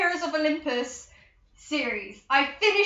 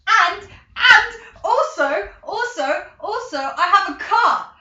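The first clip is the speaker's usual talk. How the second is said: laughing excitedly; 5 semitones higher, 7 dB louder, slower than usual. Real, not acted. Laughing with joy.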